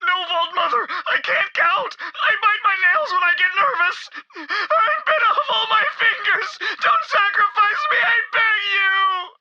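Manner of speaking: high, desperate begging; terrified pleading. Acted. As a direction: plead for your life, voice climbing. Follower begs not to be sacrificed.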